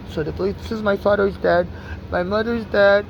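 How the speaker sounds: ordinary talk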